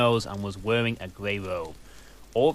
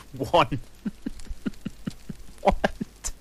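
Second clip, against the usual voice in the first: usual speaking voice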